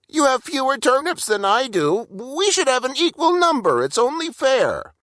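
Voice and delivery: high, insistent voice; high, insistent Burple; fussy little brother. A narrator's reading. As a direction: insist on fairness like a fussy kid. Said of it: "Burple argues fairness".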